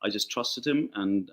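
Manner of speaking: normal talking voice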